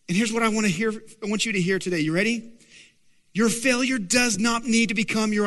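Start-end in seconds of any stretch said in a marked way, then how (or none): none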